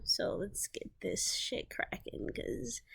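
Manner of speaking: weary whispered aside. Real, not acted. Tired aside after break.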